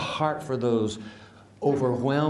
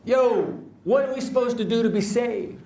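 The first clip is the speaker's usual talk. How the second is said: crowd calling out; 9 semitones higher than usual. Acted. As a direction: yell out to interrupt the preacher. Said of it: "a whole section of the listeners cry out".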